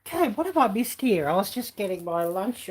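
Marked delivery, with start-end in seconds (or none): none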